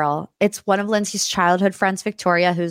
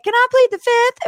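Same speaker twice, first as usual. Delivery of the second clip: high, louder coy plea; coy dodge; 5 dB louder than usual. Retold or imitated. Ashley dodging the crowd, high coy plea. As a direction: beg off playfully.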